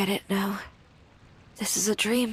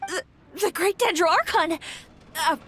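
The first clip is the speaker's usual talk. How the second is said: startled surprise; 9 semitones higher than usual. Acted. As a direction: caught off guard by a deity. Startled by the Archon's arrival.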